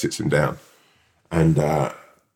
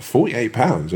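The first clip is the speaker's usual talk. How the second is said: higher, disbelieving repeat of the price; incredulous echo; 4 semitones higher, slower than usual. Retold or imitated. Customer to woman at the counter about the bill, incredulous. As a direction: throw the price back in disbelief. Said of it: "What the fuck?".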